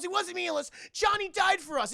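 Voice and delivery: high, loud melodramatic rebuttal; mock impassioned protest. Sonya defends Johnny's death to Jax, high mock-impassioned protest.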